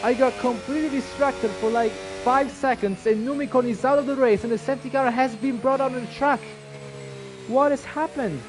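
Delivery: motorsport commentator. Real, not acted. Sudden crash called live.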